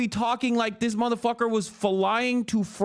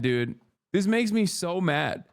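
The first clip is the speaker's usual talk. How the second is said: ordinary talk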